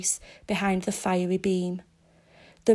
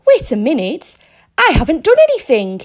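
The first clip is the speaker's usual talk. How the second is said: loud, fast desperate protest; frantic protest; 5 semitones higher, 10 dB louder, faster than usual. A narrator's reading. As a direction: plead urgently, louder and faster. Mead protests his arrest.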